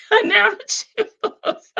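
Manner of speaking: words breaking into high-pitched laughter; real giggling squeal through the words; laughing. Real, not acted. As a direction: crack up laughing mid-sentence. Breaks up laughing while talking.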